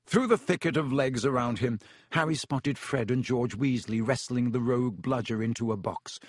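ordinary delivery

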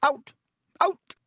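high, indignant shout, drawn out then sharp; scolding matron's high-pitched outburst. A narrator's reading. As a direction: storm in and order everyone out. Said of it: "came storming over, shouting".

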